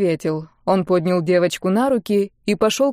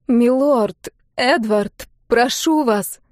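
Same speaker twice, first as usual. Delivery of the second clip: higher, imploring voice; pleading woman; 4 semitones higher, much slower than usual. A narrator's reading. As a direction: beg him, name by name. Woman begs the duke. (умоляющий женский голос)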